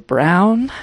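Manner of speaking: normal talking voice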